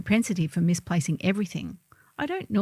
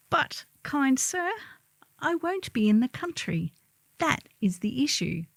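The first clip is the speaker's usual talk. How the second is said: mock calm; 6 semitones higher than usual. Customer to the bank officer, in mock calm politeness. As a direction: feign calm politeness through gritted teeth. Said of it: "I may or may not have calmly replied".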